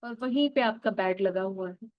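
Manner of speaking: put-on calm guide drawing the listener back to childhood; guided meditation. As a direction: lead them gently into the memory. Guides inner-child visualization.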